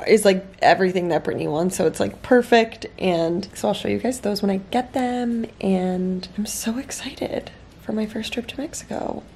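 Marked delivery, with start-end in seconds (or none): 5.6-9.2 whispering. Real, not acted. Whispering so others won't hear.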